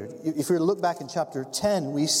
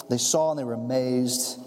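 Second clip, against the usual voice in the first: ordinary talk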